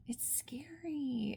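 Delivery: breathy whisper, high and slow; hushed whisper of dread; hushed scary. Real, not acted. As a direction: whisper it like a secret fright. Whispering about a scary plot.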